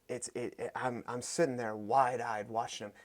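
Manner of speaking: ordinary talk, nothing marked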